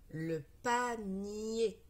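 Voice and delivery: "panier" very slow, held "pa", held "nier"; word sounded out. Shown as a demonstration. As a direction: read "panier" syllable by syllable, slowly. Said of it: "Maintenant, nous allons lire les mots". ("panier" déchiffré très lentement, syllabes allongées)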